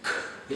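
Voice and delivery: K said as a soft breathy hard "k" puff; hard K sound. Shown as a demonstration. Teacher modelling the K sound for learners. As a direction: model the unvoiced "K" sound clearly. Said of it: "The first one is".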